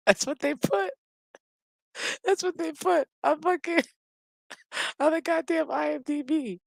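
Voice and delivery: high, squeaky through laughter; real laughing disbelief. Real, not acted. Host reading the IMDB credit, cracking up laughing. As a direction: barely get it out for laughing.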